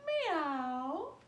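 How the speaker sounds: loud meow; cat meow. Shown as a demonstration. Teacher repeating cat pose, loud meow. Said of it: "Cat pose one more time".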